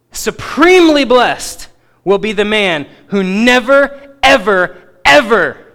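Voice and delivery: slow, forceful proclamation building to a loud "ever, ever"; emphatic. Acted. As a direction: stretch it out and hammer "never, ever, ever". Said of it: "said in an emphatic tone".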